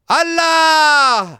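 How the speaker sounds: very high, loud, long-held scream; desperate full-force cry, stretched out; all-out shout. A narrator's reading. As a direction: gather every bit of strength and yell it. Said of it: "तितकी शक्ती एकवटून ओरडलो".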